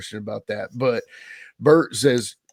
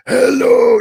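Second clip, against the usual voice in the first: much higher, much louder throat-shredding yell; yelling gimmick voice; 13 semitones higher, 13 dB louder than usual. Shown as a demonstration. Wrestler demoing his first accented gimmick, a throat-shredding yell. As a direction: belt out a strained wrestling-gimmick greeting. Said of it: "like, a yelling thing".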